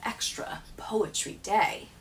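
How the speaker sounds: whispered aside; whispering. Acted. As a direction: share it like a secret. Confiding aside in the poem.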